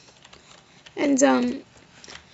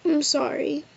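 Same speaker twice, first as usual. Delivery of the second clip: sniffly apology; 2 semitones higher, 7 dB quieter than usual. Retold or imitated. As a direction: imitate a mom quietly sorry through sniffles. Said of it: "sniffling a little bit".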